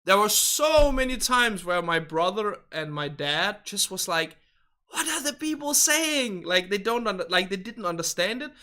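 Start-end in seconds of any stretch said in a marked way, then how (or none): 4.9-6.4 mock bewilderment, pitched high; high-pitched confused question; baffled. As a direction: imitate baffled relatives in a high voice. Imitating confused brother and dad.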